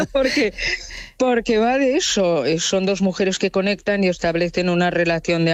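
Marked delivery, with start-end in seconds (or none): 0.0-2.5 talking through a laugh, pitched high; amused, chuckle breaking into higher speech. Real, not acted. Critic joking to radio hosts about foot massages, laughing.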